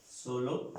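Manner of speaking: ordinary talk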